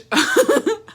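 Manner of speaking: short high-pitched laugh. Real, not acted. Laughs at comment.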